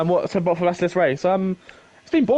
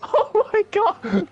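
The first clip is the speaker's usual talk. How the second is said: shocked; much slower than usual. Real, not acted. Laughing shock at the overtake.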